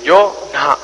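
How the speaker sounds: dismissive shrug. Retold or imitated. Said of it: "y dices".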